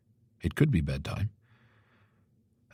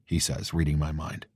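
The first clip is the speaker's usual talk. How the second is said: ordinary delivery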